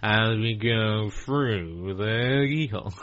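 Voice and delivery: very slow, drawn-out presenter voice; Lloyd Grossman voice. Retold or imitated. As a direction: drag it out as if through a wind tunnel. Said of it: "He had, like, a really slow".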